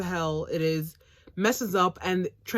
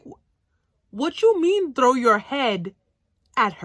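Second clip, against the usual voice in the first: mock confusion; 5 semitones higher, 7 dB louder than usual. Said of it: "Shika's just like".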